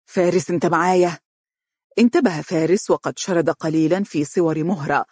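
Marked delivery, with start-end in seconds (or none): 0.0-1.2 concerned check-in; worried. A narrator's reading. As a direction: call him back, uneasy. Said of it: "فقال عمرو بقلق". (بقلق)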